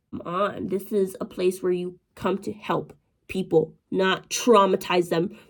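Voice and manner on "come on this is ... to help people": ordinary talk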